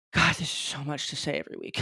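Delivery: breathless after a long rant; winded, catching breath. Real, not acted. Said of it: "I'm literally out of breath".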